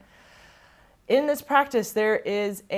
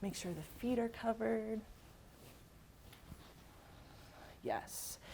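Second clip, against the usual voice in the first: much softer, half to herself; hushed aside; 3 semitones higher, 17 dB quieter than usual. Real, not acted. Yoga teacher tucking her model in, hushed aside. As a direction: murmur it quietly while busy tucking someone in.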